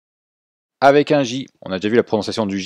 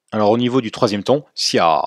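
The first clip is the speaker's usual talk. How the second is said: ordinary talk, nothing marked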